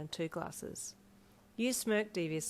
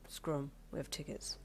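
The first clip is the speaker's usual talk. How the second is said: softer, dismissive undertone; low reply; 2 semitones lower, 7 dB quieter than usual. A narrator's reading. Darby walking up to reception, low. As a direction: keep it low and unbothered. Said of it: "he replied lowly".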